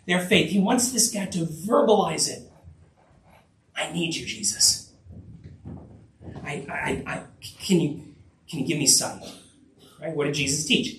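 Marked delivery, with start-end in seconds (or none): 3.8-9.2 soft, low, halting plea; hesitant plea. Acted. Blind man verbalizing his need to Jesus, hesitant. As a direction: stammer it quietly, feeling for the words.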